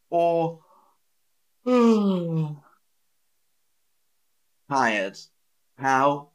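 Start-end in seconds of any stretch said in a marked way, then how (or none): 1.6-2.5 loud, high exhale acting out tiredness; big demonstrative sigh of tiredness; weary sigh. Shown as a demonstration. Teacher acting out tired for learners, weary sigh.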